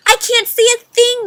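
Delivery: high, loud panicked yell. Acted. Bird yells in the dark while falling. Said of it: "yelled Flip".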